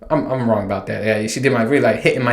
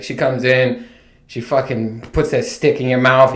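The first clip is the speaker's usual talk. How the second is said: normal talking voice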